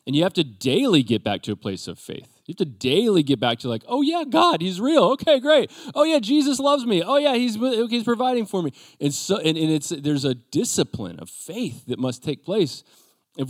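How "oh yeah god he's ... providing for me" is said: high, bright-on-purpose chirpy self-talk; mock cheery reassurance. Preacher mimics believers' daily pep talk, high mock cheer. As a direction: chirp it out like forced morning faith.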